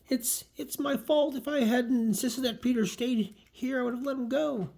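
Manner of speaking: high, slow acted anguish; distraught self-blame. Gwen blaming herself for Peter being taken, distraught. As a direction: play a comic character wailing with guilt.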